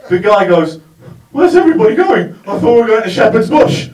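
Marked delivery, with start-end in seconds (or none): 1.3-3.9 put-on high, loud confused drunken yell; bewildered drunk shouting at him; drunk man's outburst. Drunk man confronting narrator.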